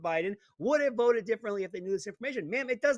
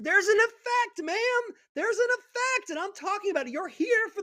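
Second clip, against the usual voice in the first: much higher and louder, pressing the point hard; heated insistence; 13 semitones higher, 4 dB louder than usual. Real, not acted. To the panelist who dismissed him, heated and raised. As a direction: hammer home that there's an effect.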